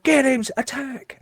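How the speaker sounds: ordering the dog. Retold or imitated. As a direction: set the dog on him. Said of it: "he's going".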